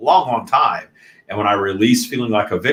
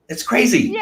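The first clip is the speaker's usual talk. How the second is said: high incredulous; 13 semitones higher than usual. Acted. Echoing her own remark.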